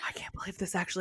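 fast, unvoiced whisper to herself; hushed aside of disbelief; stunned whisper. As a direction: breathe it out in disbelief, quickly. Inner thought, stunned teacher.

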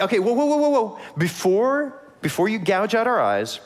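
high, hurried mock plea to stop; mock pleading whoa. Pastor voicing Israelites begging the king, hammed-up high plea. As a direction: hold up the king in a hammed-up panic.

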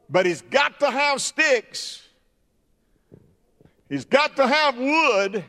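high, ringing preacher's cry; preaching climax. Acted. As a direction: lift it up and hammer it home.

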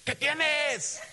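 high, loud Spanish snap out the car window; south side Spanish challenge. Retold or imitated. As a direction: bark it like a south side local. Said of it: "that's how they talk".

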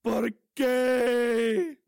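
high crying shout. Pretend. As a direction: wail it high. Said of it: "His voice, it's so bendy".

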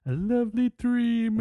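slow, high, airy lilt; mock dreamy. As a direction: draw it out like a swooning dreamer. Playful teasing, followed by laughs.

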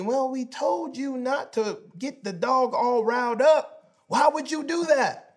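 high-pitched, reproachful parental scolding; exasperated scolding. Retold or imitated. Dad inwardly scolding his son over the riled-up dog, exasperated. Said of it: "I think to myself immediately".

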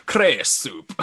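lightly imitated Scottish burr; Scottish accent. Fan quoting a favorite film dad, playful Scottish accent. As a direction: savor the words in a mock Scottish voice. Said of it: "love the way he says".

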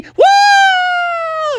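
very high drawn-out whoop; long falsetto mock leaping cry; excited whoop. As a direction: whoop as you leap off a cliff. Dad leaps over the cliff.

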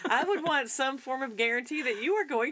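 laughing while talking; giggling through the words. Real, not acted. Joking about being stranded in space.

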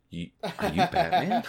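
high, laughing disbelief; surprised realization. Retold or imitated. Coworker realizing the actor is Batman, laughing surprise. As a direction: gasp it out as it dawns on you.

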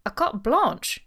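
loud, high, incredulous question; shocked cry, punched loud; stunned exclamation. A narrator's reading. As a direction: burst out in disbelief. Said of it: "he exclaimed, apparently stunned".

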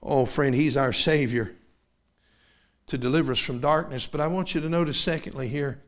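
ordinary talk, nothing marked

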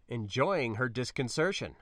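usual speaking voice

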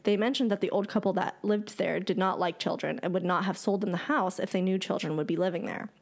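ordinary delivery

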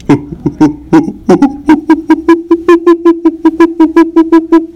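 high, shrieking monkey and bird calls; jungle animal calls. Pretend. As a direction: fill the air with wild jungle animal noises. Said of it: "That was jungle".

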